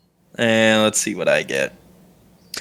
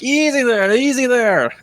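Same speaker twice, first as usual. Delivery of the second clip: calming someone down; 12 semitones higher than usual. Acted. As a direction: talk down an angry friend from across the table. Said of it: "calm him down a little bit".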